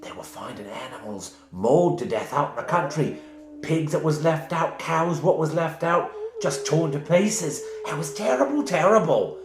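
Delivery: put-on high Cockney-style old woman; Christmas Carol laundress. Old woman telling the party about wolves, high Cockney laundress voice. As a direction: tell the tale like a Dickens laundress. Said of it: "sound like the laundress from Christmas Carol".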